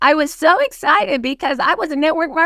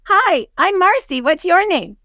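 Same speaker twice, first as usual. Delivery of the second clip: high, eager hi to a stranger; Starbucks greeting; 7 semitones higher than usual. Shown as a demonstration. Role-playing a coffee shop meeting with Garrett, bright and eager. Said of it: "I'm at Starbucks".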